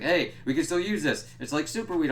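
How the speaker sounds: mock excitement. Characters discovering the grown weed, mock excited. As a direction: imitate someone thrilled by a find. Acting their delighted discovery.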